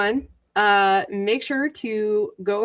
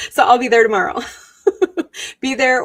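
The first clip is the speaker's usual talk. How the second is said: laughing; 3 semitones higher, 7 dB louder, much faster than usual. Real, not acted. Laughing through announcement.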